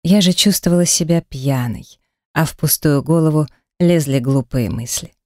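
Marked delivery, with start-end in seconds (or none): none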